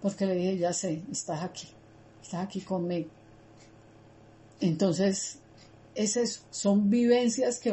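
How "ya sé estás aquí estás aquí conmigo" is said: hushed relieved prayer. Retold or imitated. Relieved prayer to God, calming.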